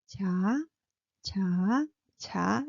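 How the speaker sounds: 자 said low and soft; relaxed low 자 with no tension. Shown as a demonstration. Teacher models relaxed 자 before tense 짜. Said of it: "low and soft".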